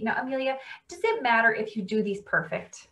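plain reading voice

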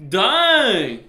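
big, high drawn-out shout; loud exclamation. Real, not acted. Player reacting to the game, loud exclamation. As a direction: holler it out, high and long.

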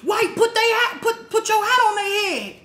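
high, fast indignant yell; mock outraged shout. Explaining to her brother why she hit him, high yell. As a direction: rant it high and fast, half laughing. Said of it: "I'm like".

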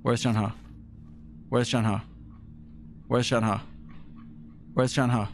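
low monotone, identical each time; deadpan repeated question. Pretend. Gamer looking for Shenhe, low deadpan repetition. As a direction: repeat it robotically, very low, with gaps. Repeatedly hunting for a missing character.

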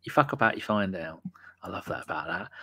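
ordinary delivery

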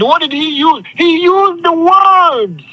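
ordinary talk, nothing marked

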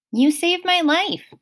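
a touch of grateful Grover; a hint of a young satyr's voice, thankful. A narrator's reading.

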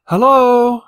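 a lone traveller hails an empty tunnel; calling out. Acted. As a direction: call into the dark, hoping for an answer.

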